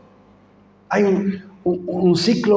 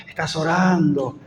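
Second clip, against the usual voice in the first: ordinary delivery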